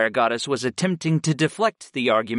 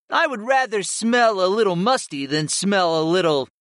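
high-pitched cat monster voice; cat familiar retorting; 7 semitones higher than usual. Acted.

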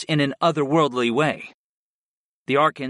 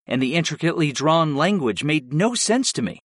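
usual speaking voice

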